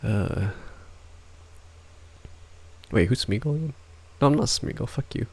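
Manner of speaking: normal talking voice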